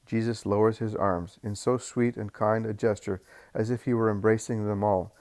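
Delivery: usual speaking voice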